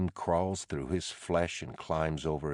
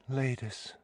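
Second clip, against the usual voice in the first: Ladis said much higher and softer, falling at the end; higher pleading; 8 semitones higher, 5 dB quieter than usual. Acted. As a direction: lift Ladis up high, then let it fall. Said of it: "she says".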